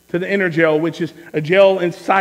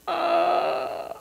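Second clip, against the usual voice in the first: high, soft drawn-out whine; stunned whimper; 9 semitones higher, 9 dB quieter than usual. Retold or imitated. Hearing his wife is pregnant, stunned whimper. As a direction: let out a small, high, stuck "uh".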